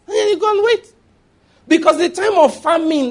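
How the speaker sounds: high and loud preaching burst; high, loud preaching emphasis; rising preaching fervor. Real, not acted. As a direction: lift it high, drive it home. Preaching climax.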